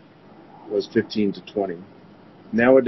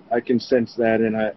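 usual speaking voice

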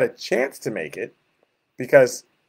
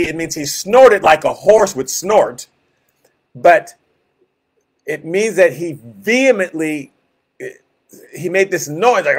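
ordinary talk

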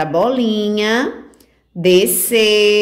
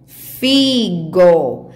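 FIGO held long on each syllable; slow teacher read-back, FIII-GO; leitura silabada; 2 semitones lower than usual. Shown as a demonstration. Teacher rereads the written word. (leitura lenta, sílaba por sílaba)

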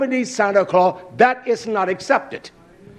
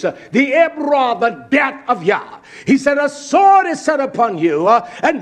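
high, raised preacher's cry; impassioned preaching; 5 semitones higher, 4 dB louder than usual. Real, not acted. Preacher calling congregation to bewail their children, impassioned preaching. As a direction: urge the congregation to weep.

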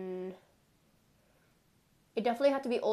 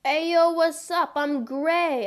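higher, louder lobby hello; hyped greeting; 5 semitones higher, 6 dB louder than usual. Real, not acted. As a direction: greet everyone with energy.

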